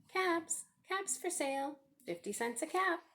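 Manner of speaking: high sing-song peddler's call; calling out wares. A narrator's reading. Peddler calling to the street, sing-song call. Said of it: "he called".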